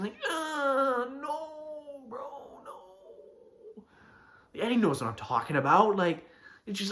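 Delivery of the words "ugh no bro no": high, soft pained whine fading away; cringing. Acted. Friend in the corner watching a failed pickup, acted cringing. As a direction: squirm with secondhand embarrassment, hammed up. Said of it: "you're sitting in the corner cringing".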